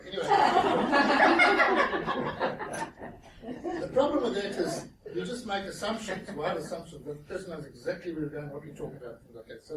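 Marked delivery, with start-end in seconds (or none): 0.3-3.8 big laugh. Real, not acted. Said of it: "own laugh".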